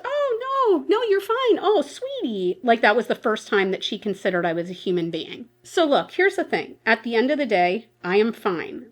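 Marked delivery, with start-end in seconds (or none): 0.0-2.6 much higher, cooing reassurance; sudden comforting. Retold or imitated. Doctor soothing the crying patient, high cooing sweetie. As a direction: coo at her like a child.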